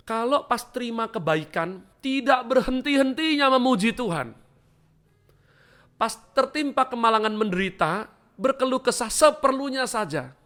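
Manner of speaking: high and loud, rising fervour; full-force preaching. Acted. Preacher urging the faithful to keep calm, fervent and loud. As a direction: preach it hard over the crowd.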